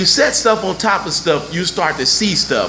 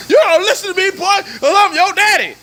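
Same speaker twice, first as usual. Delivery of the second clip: high-pitched scolding father impression; hammed-up dad laying down the law; put-on indignant parent, voice way up; mock bossy dad; 13 semitones higher, 4 dB louder than usual.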